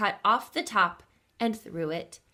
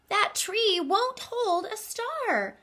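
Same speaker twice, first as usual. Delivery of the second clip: high, slow little bear voice; Little Bear crying out; 11 semitones higher, slower than usual. Acted. Little Bear calling from afar about the star, high and slow. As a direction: stretch it out high and small. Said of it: "cried out".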